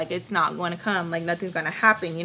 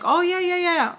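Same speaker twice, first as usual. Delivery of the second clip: much higher, bright eager "oh yeah yeah"; eager high greeting; 9 semitones higher than usual. Retold or imitated. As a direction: greet them brightly, caught off guard. Greeting the dad at door.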